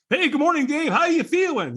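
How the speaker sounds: hammed-up chirpy morning caller; imitating enthusiastic caller. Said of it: "he's all enthusiastic that morning".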